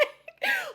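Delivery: big high laugh breaking out; high delighted cackle; high burst of laughter. Real, not acted. Laughs at own story.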